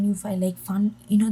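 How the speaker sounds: ordinary talk, nothing marked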